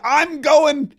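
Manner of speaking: mock eager young aide, high-pitched; imitating an excited new hire; all excited. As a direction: gush it like big news for mom. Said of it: "He's all excited".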